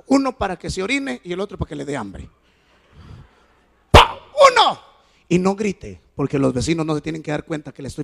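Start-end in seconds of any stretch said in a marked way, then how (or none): 3.9-4.2 sharp mouth-made smack; slap sound. Retold or imitated. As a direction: snap out a smack noise. Imitating the spank.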